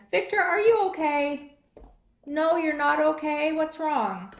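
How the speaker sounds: a hint of a worried puppet voice; puppet Aurora. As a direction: gently check on a hurt friend.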